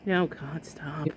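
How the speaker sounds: breathy whispered oh God stop; whispered cringe. Real, not acted. Cringing at the clip.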